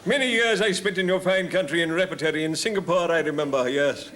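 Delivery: hammy actor buttering up a shopkeeper; old touring actor. Acted. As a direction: project grandly like an old thespian. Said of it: "a story about an old boy who's on tour".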